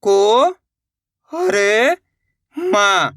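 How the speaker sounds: "को ... हर ... मा", stretched parts with pauses; letter by letter. Shown as a demonstration. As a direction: sound out "को हर मा" one by one. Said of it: "एक एक अक्षर का उच्चारण". ("को ... हर ... मा" रुक-रुककर, खींचकर)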